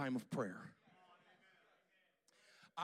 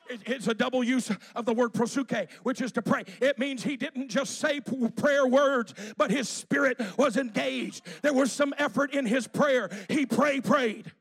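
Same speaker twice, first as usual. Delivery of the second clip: loud, higher, urgent pulpit delivery; full-force preaching; 6 semitones higher, 13 dB louder than usual. Acted. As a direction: drive it out to the congregation with effort.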